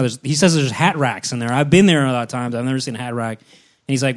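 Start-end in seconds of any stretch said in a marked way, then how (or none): none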